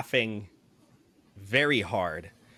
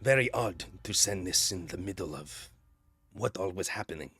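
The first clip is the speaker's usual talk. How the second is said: low, soft and deadpan; a gloomy ex-guard muttering dryly; dry dragonborn; 3 semitones lower, 4 dB quieter than usual. Acted. GM voices Kraus the dragonborn.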